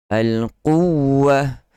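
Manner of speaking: al-quwwah with the doubled w held long and strong; stressed doubled w. Shown as a demonstration. Teacher demonstrating tajwid, al-quwwah with stressed shadda. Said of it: "So, kuat".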